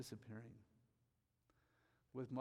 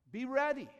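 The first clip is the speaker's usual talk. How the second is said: much higher and louder, ringing out; shouted warning; 11 semitones higher, 11 dB louder than usual. Acted. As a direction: cry it out to the congregation. Shouted climax of the exhortation.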